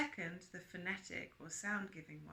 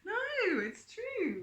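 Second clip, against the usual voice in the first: much higher and louder insisting no; insistent; 9 dB louder, much slower than usual. Real, not acted. Host insisting to sceptical friend, loud high protest. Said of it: "It's all a joke, no?".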